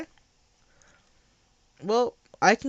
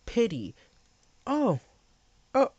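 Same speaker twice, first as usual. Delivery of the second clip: normal talking voice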